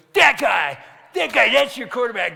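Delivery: loud imitated shout of choice; triumphant pick. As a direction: call it out suddenly, mocking and loud. Kid announces his pick.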